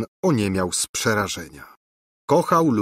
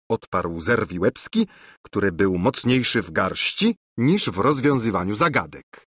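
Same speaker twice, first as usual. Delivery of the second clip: ordinary delivery, nothing marked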